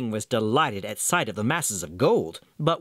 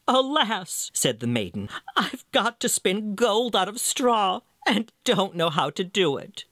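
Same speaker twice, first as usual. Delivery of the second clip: distressed maiden; 6 semitones higher than usual. Acted. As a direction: plead like a helpless girl. Maiden's lament, voiced higher.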